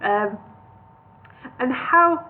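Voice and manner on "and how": high, wavering nervous question; a small hobbit quavering in awe. A narrator's reading. Bingo quavering at the sheer cliff face. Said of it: "asked Bingo quaveringly".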